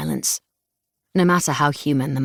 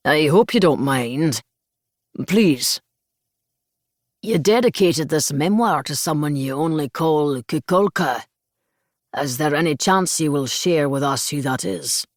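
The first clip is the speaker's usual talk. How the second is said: put-on broad Scottish accent with a swooping lilt; thick Scottish brogue. A narrator's reading. Audience member asking about a dedication, Scottish brogue. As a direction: ask politely in thick Scottish tones. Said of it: "in a thick Scottish brogue".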